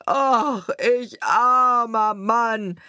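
loud, high, stretched-out cry; wailing lament. A narrator's reading. Carter seeing all his wine run out, loud lament. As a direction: wail it in self-pity, drawing out the words.

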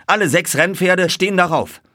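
high, loud announcement; calling out. A narrator's reading. Narrator tells the others all six horses run, calling out. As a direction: call out eagerly over the racecourse. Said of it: "rief ich".

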